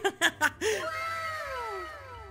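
helpless high laughing burst; burst of laughter. Acted. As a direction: laugh out uncontrollably. Power makes him laugh.

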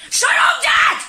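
very high loud yell; shouting. Real, not acted. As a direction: yell it at him. Yelling at dad.

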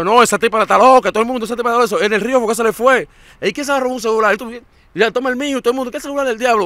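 loud, high-pitched indignant protest; acted-out angry complaint; exasperated outburst. Acted outburst at woman delaying everyone.

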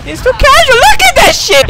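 jumps way up in pitch; exasperated. Real, not acted. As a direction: rants in a high exasperated burst. Gamer raging at lobby.

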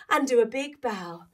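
usual speaking voice

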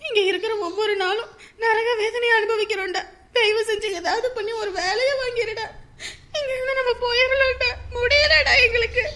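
mother begging her son through sobs; crying plea. Acted. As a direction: plead through tears, voice climbing.

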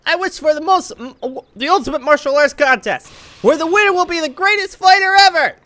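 high, rising cartoon proclamation; game character's grand wish. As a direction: declare the wish high and excited. Dubs character making wish.

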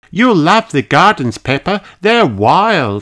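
put-on high, bright little girl; young girl voice. A narrator's reading. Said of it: "said Kylie".

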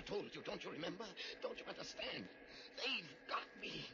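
urgent panic building to a cry; desperate. Acted. Professor begs John to shoot him, rising panic.

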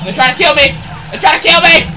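yelling in panic; panicked yell. Real, not acted. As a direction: scream for help while fleeing. Chased by enemies, yelling.

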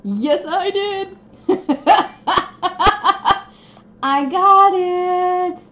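high, slow, laughing squeal; gleeful squeal. Real, not acted. Revealing the kit she wanted, squealing gleefully. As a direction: savor the win with a giggle.

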